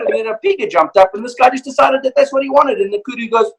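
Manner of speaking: normal talking voice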